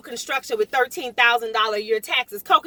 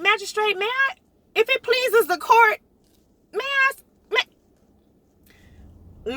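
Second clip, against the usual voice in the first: high, hesitant plea to the magistrate; mock courtroom politeness; 7 semitones higher, much slower than usual. Pleading with the magistrate at her hearing, mock formal. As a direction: stammer it out like a nervous petitioner. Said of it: "I'm like".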